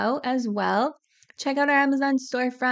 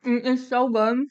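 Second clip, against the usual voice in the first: talking through a mouthful of food; chewing while talking; mouth full. Real, not acted. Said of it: "I know I'm talking with my mouth full".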